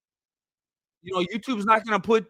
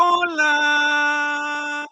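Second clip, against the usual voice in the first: long stretched high call of the name, raised and held; drawn-out call; 9 semitones higher, 4 dB louder, much slower than usual. Real, not acted. Streamer hailing a viewer in chat with a long drawn-out high call.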